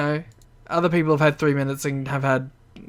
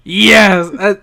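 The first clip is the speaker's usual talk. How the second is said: excited; 4 semitones higher, 7 dB louder than usual. Real, not acted. Cheers his wish coming true.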